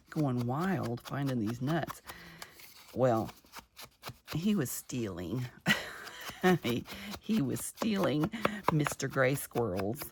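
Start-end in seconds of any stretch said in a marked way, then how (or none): 5.5-6.6 a quick, high, breathy laugh; burst of laughter. Real, not acted. Vlogger laughing to viewers about the squirrel, quick breathy laugh. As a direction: laugh out loud at the little thief.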